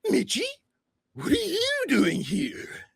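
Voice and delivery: high, loud, squealing clown voice; Pennywise. Acted. Game master as clown greeting Mitch, high squealing surprise. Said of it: "Pennywise looks at you and goes".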